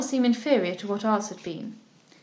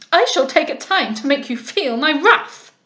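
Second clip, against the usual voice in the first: loud, raised indignant threat; angry threat; 3 semitones higher, 7 dB louder than usual. A narrator's reading. Lovell threatening the captain, loud and angry. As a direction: threaten him through rising fury. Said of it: "angrily demanded".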